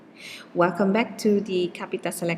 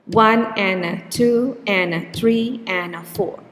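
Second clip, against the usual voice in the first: numbers on the beat, "and a" squeezed between; "One and a two and a three and a four" with stress on one, two, three and four and "and a" rushed; beat-timed counting. Shown as a demonstration. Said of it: "Let's take a look at number three".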